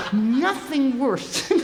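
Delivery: slow words through laughter; amused, drawn out; laughing. Real, not acted. Laughing while speaking.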